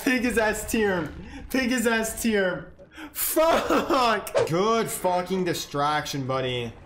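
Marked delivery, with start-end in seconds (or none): 3.1-4.3 high laughing wail; high, broken cry of laughter; laughing dismay. Real, not acted. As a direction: curse while cracking up.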